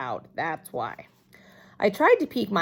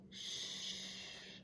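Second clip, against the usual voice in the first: unvoiced, very soft shush; whispered shh; 24 dB quieter than usual. A narrator's reading. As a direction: hush her with a breathy shh. Boy shushing her.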